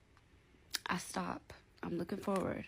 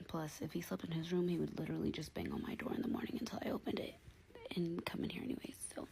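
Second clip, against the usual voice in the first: whispering; 6 dB quieter, faster than usual. Real, not acted. Mother talking while her baby sleeps in her bed, whispering. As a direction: keep it down, real whisper. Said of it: "I'm whispering because my baby's asleep".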